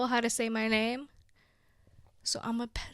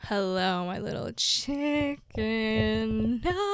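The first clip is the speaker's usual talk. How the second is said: slow, goofy put-on greeting voice; a goofy cartoonish hello; weird silly voice; slower than usual. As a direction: drag the words out in a silly voice. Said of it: "doing these weird sounds lately".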